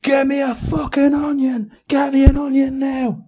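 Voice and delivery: red-faced, high, full-force scream. Retold or imitated. Stung man to the girl, screaming for help. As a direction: scream the demand at the top of your voice. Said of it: "Screaming at her".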